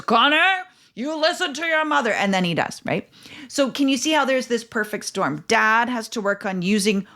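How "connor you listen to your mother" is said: higher-pitched, quick mock-stern dad. Dad steps in when Connor ignores mom, mock-stern command. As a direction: step in as the commanding father. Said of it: "Dad probably steps in and says".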